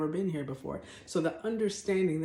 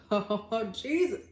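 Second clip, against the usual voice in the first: laughing; 4 semitones higher, slower than usual. Real, not acted. Laughs at own anger.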